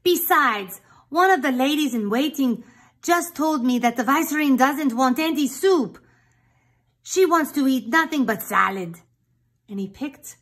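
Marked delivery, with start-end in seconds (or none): 0.0-9.0 loud, fed-up cook, higher; exasperated exclamation. Acted. Cook fed up with the troublesome chicken, loud and exasperated. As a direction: throw up your hands at the rooster, loud. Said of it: "exclaimed the cook".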